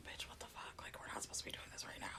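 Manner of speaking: urgent whispered aside at work. Retold or imitated. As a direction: hiss it so coworkers can't hear. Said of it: "and you're like".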